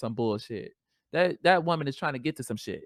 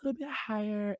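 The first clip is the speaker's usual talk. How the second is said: lifted, softer higher pitch; raised voice, kept soft; slightly higher voice; 8 semitones higher, 7 dB quieter than usual. Shown as a demonstration. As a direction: let the voice sit a little higher, quietly. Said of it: "my voice is".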